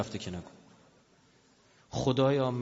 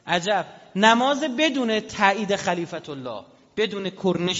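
slow emphasis; 5 semitones higher, 5 dB louder, slower than usual. Real, not acted. Preacher stresses key phrase.

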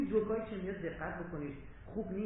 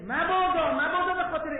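a sudden loud, high plea of mabada; loud warning cry; 8 semitones higher, 10 dB louder than usual. Real, not acted. Preacher warning the crowd against bloodshed, loud plea.

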